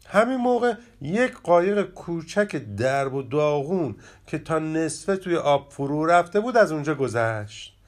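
plain reading voice

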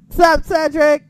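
loud shout-out. Real, not acted. Shout out to wrestler.

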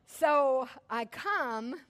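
usual speaking voice

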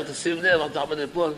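soft, polite little caution; timid warning. Retold or imitated. Friend politely warning a man near a pit, soft and mild. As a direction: murmur the warning far too gently.

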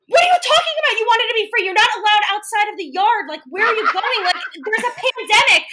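high, loud exasperated scolding; scolding the kids. Retold or imitated. Aunt scolding kids who ran off, loud and high. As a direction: tear into them, loud and high.

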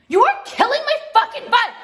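fed-up shout at the viewers; exasperated yell. Real, not acted. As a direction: let it burst out in frustration. Angry outburst at commenters.

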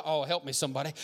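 ordinary talk

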